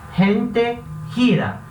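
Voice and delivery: rente and gira with a raspy h; Spanish g as h. Shown as a demonstration. Teacher repeating Spanish g words. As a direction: gira said hira, throaty start. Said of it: "vai ter o som do R de rato".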